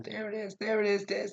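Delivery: high mock chorus of viewers; sing-song, pointing it out; viewers calling out. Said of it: "You're all saying".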